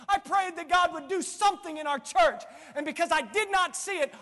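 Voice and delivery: loud, high, insistent listing; fed-up complaint. Acted. Believers who gave up on prayer, fed-up complaint. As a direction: hammer out each unanswered prayer.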